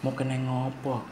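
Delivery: soft hospital voice. Retold or imitated. Teacher shows a visitor's hushed talk in hospital. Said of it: "Tapi ngomongnya piye?".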